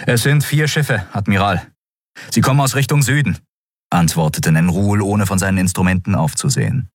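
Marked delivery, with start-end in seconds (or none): 0.1-3.4 quick, higher-pitched urgent report; brisk bridge officer; officer's report. Acted. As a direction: report tensely to the admiral.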